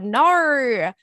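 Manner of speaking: loud playful yell, jump up in pitch; mock shout. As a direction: blurt it out loud. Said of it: "I said out loud".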